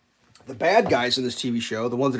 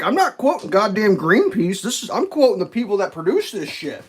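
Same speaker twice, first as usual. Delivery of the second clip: raised, louder indignant snap; the lady fires back, offended; indignant retort; 8 semitones higher, 7 dB louder than usual. Retold or imitated. Lady on the show, indignant and raised. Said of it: "the lady's like".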